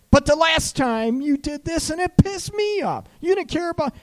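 very high, loud mock outrage; tit-for-tat retort. Coach acting out a partner's comeback in a quarrel, mock furious. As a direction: fire back as the wronged partner, loud and shrieky-high. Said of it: "it's about tit for tat".

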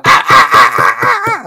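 very high, repeated squeals; bumping yelps. Retold or imitated. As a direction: squeal on every bump down the steps. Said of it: "she's hitting each step going".

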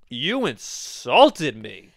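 very slow, drawn-out mock indignation; milk every syllable of the grievance; mocking offended protest. Grandson mimicking grandma's wounded pride at a million-dollar offer, slow mock offence.

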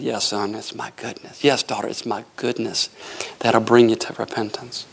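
low, gentle voice of God; calm, deep fatherly assurance; tender fatherly reply. Retold or imitated. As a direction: answer a child reassuringly, pitch dropped. God's tender answer acted out.

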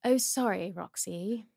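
put-on slow, halting royal English accent; Prince William. Prince turning down a woman's advance, slow English accent. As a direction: refuse politely in measured royal English. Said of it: "do you like Prince William".

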